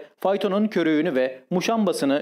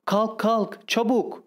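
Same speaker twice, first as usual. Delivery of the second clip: raised pitch, restrained mock shout; urgent shout; 7 semitones higher, much slower than usual. A narrator's reading. Wife shaking her sleeping husband awake, urgent high-pitched cry. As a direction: urge a sleeper up in a high voice without full volume. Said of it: "diye bağırdı".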